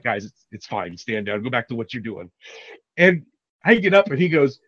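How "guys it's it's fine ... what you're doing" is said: low, soft stand-down order; calming the team. Retold or imitated. Boss telling his security guys to stand down, low and calm. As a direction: quietly wave the guys off.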